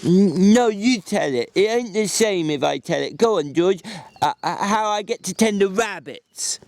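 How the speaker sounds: Lenny voice. A narrator's reading.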